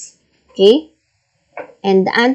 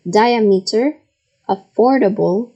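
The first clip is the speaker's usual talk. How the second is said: usual speaking voice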